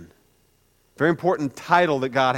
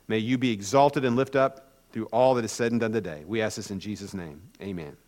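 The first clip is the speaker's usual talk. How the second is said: lower, softer spoken prayer; 5 semitones lower, 5 dB quieter than usual. Real, not acted. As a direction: pray reverently, letting the amen drop away. Leads a prayer.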